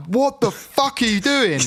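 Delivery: high, slow, drawn-out disbelief; incredulous. Retold or imitated. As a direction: retell your baffled outburst, pitched right up. Acts out his shocked reaction.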